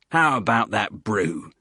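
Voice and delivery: calm the soldier down, Cockney style; placating Cockney hiker. Acted. Hiker calms the angry instructor in a Cockney voice.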